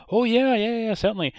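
high, loud, eager agreement; eager yes-man. Retold or imitated. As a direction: gush yes like a keen new worker. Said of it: "And John Gordon Sinclair says".

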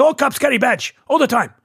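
put-on high, insistent Persian-accented driver. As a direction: imitate a Persian driver arguing his point. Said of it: "I'm doing the accent".

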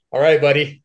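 mock a tolerant professor; professor's indulgent reply. Professor to a student refusing the northern dialect, indulgent. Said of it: "my professor's like".

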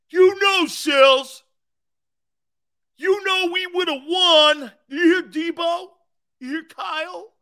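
high, loud whiny fan yelling shut up; sore Niner fan. Retold or imitated. Mocking a 49ers fan to his Eagles guest, high and whiny. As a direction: sulk and shout like a fan who lost.

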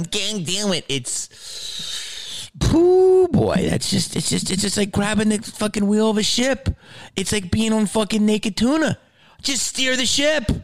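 high, mocking voice of a lazy critic; mocking a clueless heckler. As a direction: sneer it out as a know-nothing. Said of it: "just is going".

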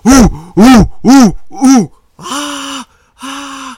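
high, loud whooping crowd cheers; crowd noises. Acted. Game master voicing the crowd at a fight, high loud oohs and ahs. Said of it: "you hear the crowd, like".

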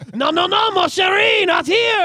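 high, loud indignant French refusal; mock French protester. Podcaster acting out French protesters refusing a voting change, mock indignant. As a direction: put on a loud, high-pitched Frenchman saying no.

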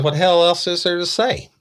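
high, loud, incredulous bark; exasperated outburst. Retold or imitated. Detective snapping at a suspect, loud and incredulous. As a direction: throw the question back at her in disbelief.

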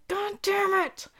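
high, frustrated groan of a curse; exasperated. Retold or imitated. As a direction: throw up your hands. Places closed on Monday.

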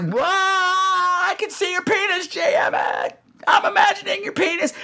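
high, slow, horrified shout; mock shout. Horrified at the mental image, a high slow shout. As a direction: yell it in mock horror. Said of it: "And I'm like".

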